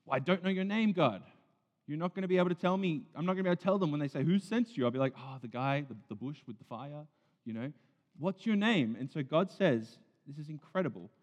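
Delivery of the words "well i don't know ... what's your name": higher, louder, rushed Moses protest. Retold or imitated. Moses arguing with God about his name, rushed and high. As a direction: pile on the excuses fast and high. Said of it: "one of the excuses he makes is".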